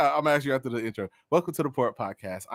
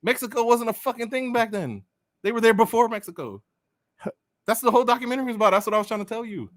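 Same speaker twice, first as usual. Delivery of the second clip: high, heated arguing; high-pitched frustrated explaining; exasperated insistence; 9 semitones higher, 4 dB louder than usual. Real, not acted. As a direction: press the point in a raised, worked-up pitch. Arguing the point about Mayans, exasperated.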